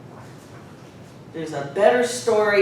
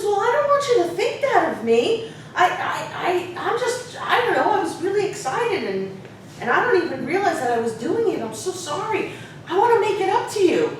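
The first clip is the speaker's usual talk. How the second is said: imitated sincere kid, high-pitched and flustered; youthful childlike student; 10 semitones higher than usual. Student apologizing to the teacher he upset, childlike and earnest. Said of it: "childlike way of speaking".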